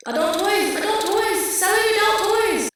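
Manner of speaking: high, full-volume imitated scream. Delivery man bursting into the house, screaming. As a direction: scream it out like a frantic street seller. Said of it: "like, just screaming".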